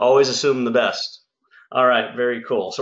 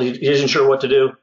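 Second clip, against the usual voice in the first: ordinary talk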